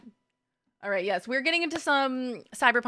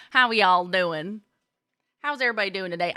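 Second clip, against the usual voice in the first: put-on playful Southern-accented greeting; folksy Southern accent; Southern drawl; faster than usual. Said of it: "with a Southern drawl".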